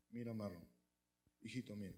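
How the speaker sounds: low, soft motherly advice; hushed voice of a mother instructing; mother confiding. Acted. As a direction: quietly coach your little son. Voices mother advising her little son.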